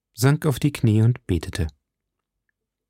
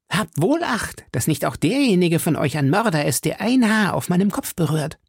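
high-pitched, vehement warning; threatening warning; 7 semitones higher than usual. A narrator's reading. Milady warns the men not to touch her, high and threatening. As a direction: warn them off in a high, agitated voice.

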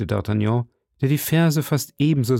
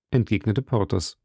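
ordinary delivery, nothing marked

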